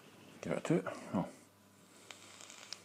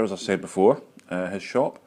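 normal talking voice

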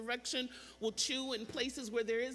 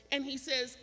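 ordinary talk

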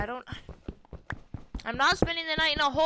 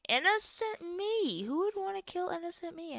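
mock innocent; 7 semitones higher, 3 dB quieter than usual. Mock self-pity, playing innocent.

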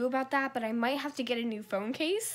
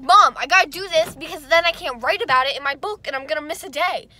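put-on pleading child; 4 semitones higher, 9 dB louder than usual. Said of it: "And I was like".

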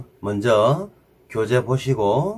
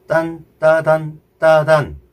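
딴, 따단, 따단 with each 딴 punched loud; accent every 딴 hard; accented rhythm; 2 semitones higher, 3 dB louder than usual. Shown as a demonstration. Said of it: "자, 보세요".

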